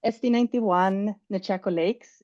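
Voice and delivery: ordinary talk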